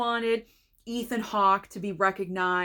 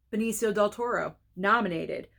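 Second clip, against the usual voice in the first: ordinary delivery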